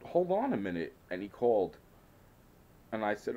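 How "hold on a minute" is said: retold exasperation. Retold pushback at Dad.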